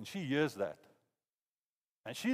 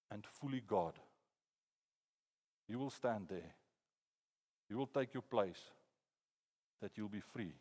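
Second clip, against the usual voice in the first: soft merciful God; 5 semitones lower, 7 dB quieter than usual. Retold or imitated. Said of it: "he looked at me and he's like".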